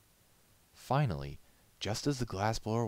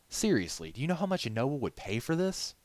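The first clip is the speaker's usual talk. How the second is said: higher, louder urging; insistent; 9 semitones higher, 5 dB louder than usual. A narrator's reading. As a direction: press the point eagerly. Glassblower pressing Styx.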